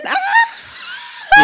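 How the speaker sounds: squeaking way up into a cracked high note. Shown as a demonstration. Showing how he sings high notes, a cracked laughing squeak. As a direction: strain for a note far too high, laughing. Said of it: "Like when I try to sing high notes".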